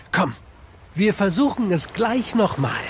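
usual speaking voice

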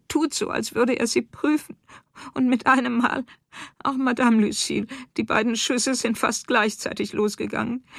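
high, agitated voice of a shaken wife; distraught account. Acted. Farm wife telling Lucille of the shooting, distraught.

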